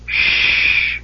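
long breathy shush; hushing shh. A narrator's reading. Gully dwarf silencing the warrior, hushing shh. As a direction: shush them with a drawn-out hiss. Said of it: "put a grubby finger to his lips".

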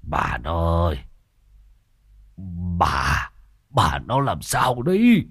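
weak, drawn-out call to his wife; halting old man's voice, starting low; feeble old man. A narrator's reading. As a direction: struggle to get the words out, slowly. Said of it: "Ông thều thào".

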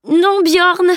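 high, loud wailing no; whining protest. A narrator's reading. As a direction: Salim wails as Bjorn hoists him onto his shoulder. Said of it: "gémit Salim".

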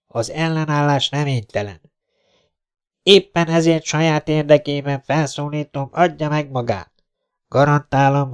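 high-pitched, measured official surrender demand; captain on loudspeaker. Acted. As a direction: project it across the street, formal and insistent. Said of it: "odakint felharsant egy hangszóró". (hangszóróba beszélő százados hivatalos felszólítása)

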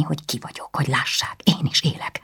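furious hissed whisper. A narrator's reading. Anna hisses back at her brother, whispering. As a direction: spit it out under the breath.

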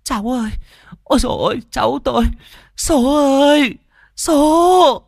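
high, loud mourning wail; wailing cry. Acted. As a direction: lament the lost grandchild. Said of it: "Bà Mõm khóc giống lên".

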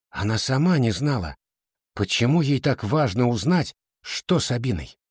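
ordinary delivery, nothing marked

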